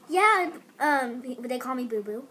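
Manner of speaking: normal talking voice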